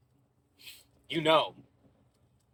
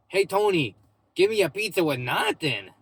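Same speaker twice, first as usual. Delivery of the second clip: louder and higher, calling across the pizza shop; mock customer calling out; 5 semitones higher, 4 dB louder than usual. Customer shouting his order to Tony, mock call. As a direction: holler the order back to Tony, playfully.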